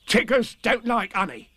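high, emphatic tiger verdict; decisive bouncy tiger; very decided. A narrator's reading. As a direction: declare it firmly, high-pitched. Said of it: "in a very decided voice".

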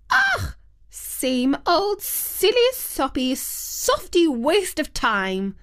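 high disgusted groan, drawn out slow. A narrator's reading. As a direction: sneer it slowly, high and disgusted. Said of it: "commented the wicked Queen".

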